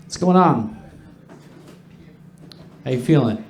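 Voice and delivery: ordinary talk, nothing marked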